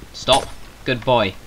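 usual speaking voice

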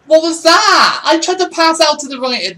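high, loud outraged shout; frustrated yell. Real, not acted. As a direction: yell in exasperation at the game. Angry at a failed pass.

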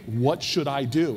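acted-out exasperation, slow and emphatic; exasperated demand. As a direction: demand an answer, out of patience, stretching each word. Retold impatient demand to Miss Jones.

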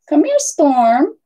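usual speaking voice

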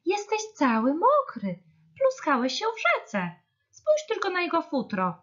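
high-pitched fussing mother voice; scolding. A narrator's reading. Hare's and squirrel's mothers to soaked bear, scolding. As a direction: fuss in a high, dismayed voice.

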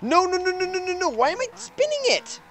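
very high, wailing no's; desperate high-pitched pleading; anguished denial. Real, not acted. Car spinning out.

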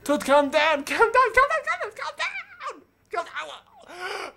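very high, loud, repeated yelling; frantic shouting. Real, not acted. Player begging Toot to calm down, frantic high shouting. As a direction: shriek it over and over, fading off in level. Player yells at game character.

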